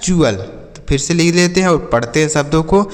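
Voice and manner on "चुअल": चुअल with CH, falling at the end; चुअल opening on CH, not T; correct pronunciation. Shown as a demonstration. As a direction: answer चुअल as "choo-al". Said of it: "तो इसे क्या पढ़ना है".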